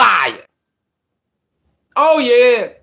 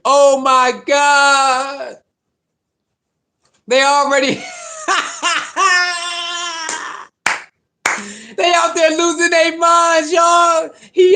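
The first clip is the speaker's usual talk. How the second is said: excited shouting; 6 semitones higher, slower than usual. Real, not acted. Hyping fans over new running game, laughing shouts. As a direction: lose it with glee.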